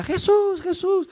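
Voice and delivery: high pitched call across a crowd; crying out. Retold or imitated. Blind man calling out to Jesus over the crowd, high shout. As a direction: shout the name up high, pleading. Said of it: "el chabón empieza a gritar".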